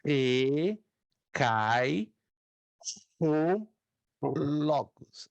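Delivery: "Enarre cai o logos" spaced out with long pauses; slow dictation. Shown as a demonstration. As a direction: read it word by word, pausing. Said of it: "Repito uma vez mais".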